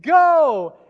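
loud, high, held "go"; announcer launching the crowd; shouted starting call. Retold or imitated. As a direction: yell the start signal over a mic. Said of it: "I'm on the mic and I say".

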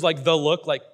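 ordinary talk

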